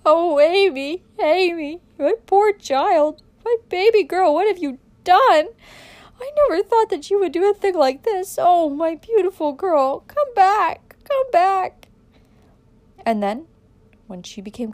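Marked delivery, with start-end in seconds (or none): 0.0-11.9 loud, high-pitched wailing grief; anguished, drawn-out moaning cry; moaning and sobbing. A narrator's reading. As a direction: wail slowly like a heartbroken mother. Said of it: "fell to moaning and sobbing".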